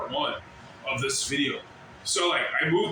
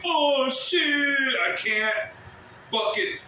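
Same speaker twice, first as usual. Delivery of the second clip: mock shocked cry; 7 semitones higher, slower than usual. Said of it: "that's the world perspective".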